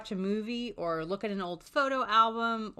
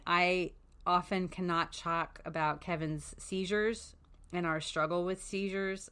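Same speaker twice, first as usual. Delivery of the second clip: usual speaking voice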